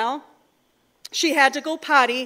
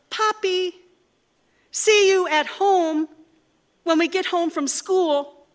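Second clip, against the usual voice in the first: put-on children's voices; 4 semitones higher than usual. Children chime out from back seat.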